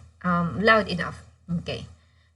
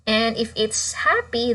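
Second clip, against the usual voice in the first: ordinary delivery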